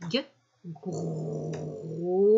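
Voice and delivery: slow syllable-by-syllable groseille; sounding out. Shown as a demonstration. Teacher sounding out a word for young pupils, slowly. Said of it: "Alors, gr, gr".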